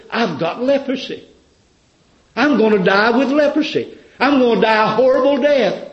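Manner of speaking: high, loud panicked wail; terrified cry. Acted. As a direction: cry out in terror of death.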